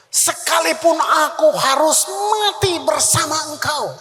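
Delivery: shouted pledge; bold vow. Retold or imitated.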